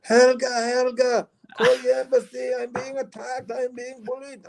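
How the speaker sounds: put-on high, slow Swedish accent, pleading and wailing; fake Swedish accent. Robber calling to a backpacker girl for help, wailing fake Swedish accent. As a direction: cry out to Helga like a distressed Swedish tourist. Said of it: "you put on the voice".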